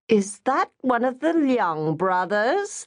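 a curious auntie peering over at the next table; mother asking. Acted. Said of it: "their mother had asked".

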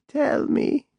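high, airy, creaky crone; creaky old voice. A narrator's reading. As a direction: whisper it like a creaky old woman. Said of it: "in that creaky old voice".

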